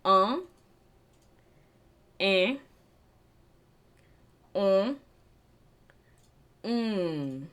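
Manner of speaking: um, eh, um, mm said through the nose; drawn-out nasal vowel sounds. Shown as a demonstration. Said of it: "I'll repeat".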